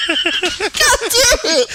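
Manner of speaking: high, slow, laughing cry of frustration; laughing exasperation. Real, not acted. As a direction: groan it out through laughter. Losing at the name game.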